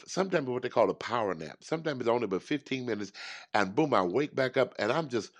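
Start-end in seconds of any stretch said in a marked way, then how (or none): none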